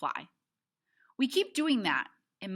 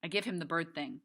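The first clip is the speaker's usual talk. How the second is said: usual speaking voice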